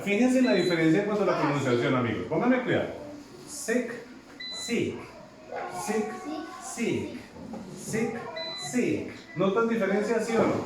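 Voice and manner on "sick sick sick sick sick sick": sick said with spread lips as if smiling; sick with a smile. Shown as a demonstration. Teacher drills sick for the class, smiling on the vowel. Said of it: "la estoy pronunciando como si me estuviera sonriendo".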